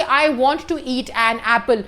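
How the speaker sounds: very slow, clear example sentence; slow dictation. Shown as a demonstration. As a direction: spell it out word by word for the class. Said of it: "जैसे".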